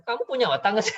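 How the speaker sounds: mock scolding, pitched high; harsh parent. Parent to child with shoes swapped, high mock scolding. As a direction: imitate a rude parent, amused underneath. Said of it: "yang kasar mah begitu".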